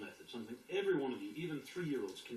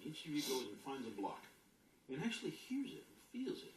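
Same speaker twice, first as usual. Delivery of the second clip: ordinary delivery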